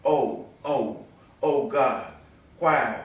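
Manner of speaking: impassioned plea, each word pushed out; anguished poetic lament. Acted. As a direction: cry out in pleading recitation. Poem climaxes in lament to God.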